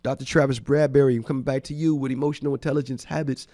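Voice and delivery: usual speaking voice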